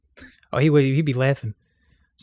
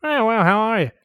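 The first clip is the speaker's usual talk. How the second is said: higher, put-on cartoon greeting; cartoon impression; 8 semitones higher than usual. Co-host copies the host's demonstration, high-pitched impression. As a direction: copy the high cartoon voice back. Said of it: "Like, he's like".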